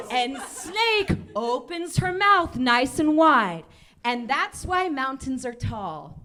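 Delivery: very slow storyteller close; fable ending. A narrator's reading. Comic finishing the fable for the crowd, very slow. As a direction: land the moral slowly.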